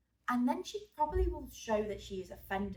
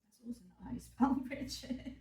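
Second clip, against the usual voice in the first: laughing at her own typo; real amused laugh through the words; 4 semitones higher than usual. Real, not acted. Teacher laughing at misspelling Richard while recording, amused.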